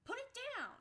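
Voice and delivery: high outraged command to the shop lady; retold command. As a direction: demand she put the crab down. Ordering lady to drop crab.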